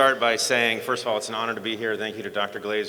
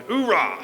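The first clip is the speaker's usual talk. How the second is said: loud, high mock Marine oorah; imitated Marine grunt; 7 semitones higher, 5 dB louder than usual. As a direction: belt a Marine cheer, falling at the end. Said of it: "favorite grunting noises is going".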